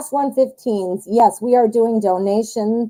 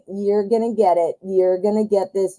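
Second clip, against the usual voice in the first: ordinary talk, nothing marked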